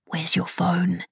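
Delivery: slow, silent mouthed whisper. A narrator's reading. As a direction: barely breathe the words, slowly. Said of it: "he mouthed to her".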